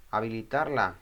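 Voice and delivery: normal talking voice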